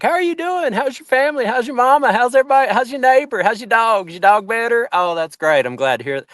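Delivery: very high, bubbly church-hug greeting; overly friendly high-pitched church-lady chatter; Southern church greeting. Retold or imitated. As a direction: gush over them like a warm Southern churchgoer. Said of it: "you give everybody, like, a church hug and you're like".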